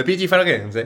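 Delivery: loud, playful Italian lilt; Italian accent. Pretend. Vlogger names the perfume fair to viewers, playful Italian accent. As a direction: swell into hammed-up Italian. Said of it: "J'essaye de parler italien".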